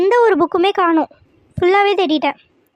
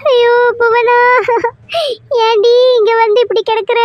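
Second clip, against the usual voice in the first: crying out; 3 semitones higher than usual. Acted. Mother crying over her daughter lying on the ground. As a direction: cry her name through tears.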